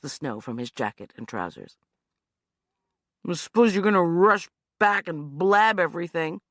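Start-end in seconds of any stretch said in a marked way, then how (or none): 3.3-6.4 bitter. Acted. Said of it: "he said bitterly".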